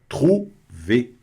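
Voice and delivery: trouver with the r from the throat; throaty French r. Shown as a demonstration. Teacher stressing the throat r in trouver. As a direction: let the r in trouver come from the throat.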